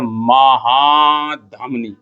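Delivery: "महाधमनी" को धीरे, खींचकर बोलें; "महाधमनी" में "महा" लंबा, अंत में गिरता सुर; शब्द सिखाने का लहजा. Shown as a demonstration. (नया शब्द सिखाते हुए "महाधमनी" बहुत धीरे)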